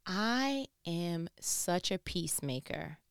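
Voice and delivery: usual speaking voice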